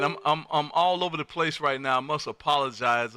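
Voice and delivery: normal talking voice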